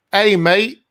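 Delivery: amateur Australian impersonation; Australian accent. Acted. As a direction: try an exaggerated Australian "hey, mate". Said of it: "accents and impersonations".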